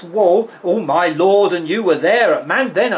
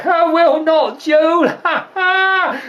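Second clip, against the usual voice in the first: put-on high, giggly delight at an old joke; tickled, cackling gossip; laughing retelling; 7 semitones higher, slower than usual. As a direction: tell the punchline through laughter. Nurse retells husband's joke, laughing.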